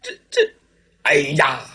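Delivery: ordinary delivery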